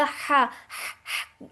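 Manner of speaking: ہا breathed out from the middle of the throat. Shown as a demonstration. Teacher showing students the sound ہا from the middle of the throat. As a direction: say ہا slowly as a breathy mid-throat h. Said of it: "ہا حلق کے درمیانی حصے سے نکلے گا".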